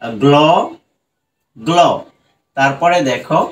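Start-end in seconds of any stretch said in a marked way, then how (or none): 0.2-1.8 গ্ল held long, as a syllable on its own; broken into syllables. Shown as a demonstration. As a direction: sound out গ্ল separately, pausing after it. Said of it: "ভেঙে ভেঙে উচ্চারণ করি".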